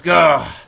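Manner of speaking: short loud hammed-up grunt as he swings; a comic-book strike yell, half-acted; mock battle grunt. Reviewer reading Casey's swing at his dad, mock grunt.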